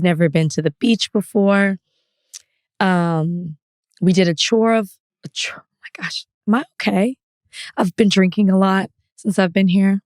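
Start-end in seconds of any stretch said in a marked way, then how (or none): 5.2-6.2 sudden whisper. Real, not acted. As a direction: drop to a breathless whisper. Flustered aside mid-story.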